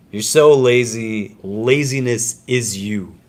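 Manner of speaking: mock scold a lazy student, slowly; emphatic scolding. Shown as a demonstration. Teacher pretending to scold a student, emphatic lazy.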